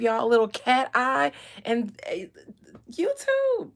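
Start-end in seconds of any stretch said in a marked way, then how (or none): 2.9-3.7 high call. Acted. High sing-song call.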